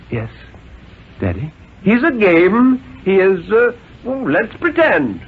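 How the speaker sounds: lightly voiced father growing impatient; a hint of an exasperated father; father's voice. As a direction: press the stubborn boy, losing patience. Father grows insistent.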